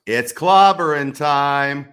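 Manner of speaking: loud, slow growled catchphrase; Thing catchphrase. Acted. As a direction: bellow it like The Thing. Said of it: "The Thing from the Fantastic Four".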